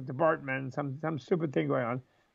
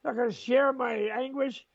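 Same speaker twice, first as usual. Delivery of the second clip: mock indignant; 7 semitones higher than usual. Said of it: "first I was like".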